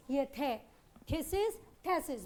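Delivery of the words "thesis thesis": thesis said slowly and carefully, twice; pronunciation drill. Shown as a demonstration. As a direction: spell out thesis slowly for students to copy. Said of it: "हम लोग बोलेंगे thesis".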